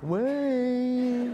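pleading call. Retold or imitated. Mike calling again.

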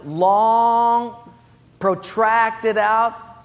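emphatic, elongated delivery; drawn-out words. Real, not acted. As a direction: drag out "long" and "protracted" to show delay. Stretches the words to show delay.

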